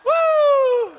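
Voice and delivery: very high, loud "woo"; exultant whoop. Real, not acted. Preacher overcome while praising the Lord, exultant whoop. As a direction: let out a long, high whoop.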